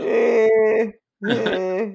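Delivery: high, small squeaky voice; tiny voice of God. Pretend. Friend voicing God as a still small voice, high squeak. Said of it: "That's the still small voice".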